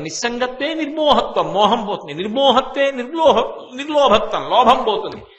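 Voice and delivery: amused recital of the verse; laughing recitation, rising in pitch; laughing through a recitation. Real, not acted. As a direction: chant the verse with laughter breaking in. Chanting the shloka with laughs.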